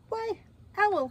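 high-pitched mouse hail; high-voiced storybook greeting; cheery mouse greeting.

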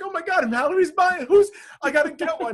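high, loud panicked shout; frantic panic. Retold or imitated. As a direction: yelp like you're about to miss out. Retold panic about Mallory buying.

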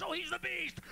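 high yelled accusation; hammed-up horrified shout; mock outraged shout. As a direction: scream it like a scandalized crowd. Imagined crowd yelling accusation.

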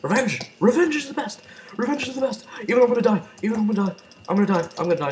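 hyped battle cry. Real, not acted. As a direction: shout it like a war cry. Charging for revenge in game.

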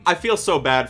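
ordinary delivery

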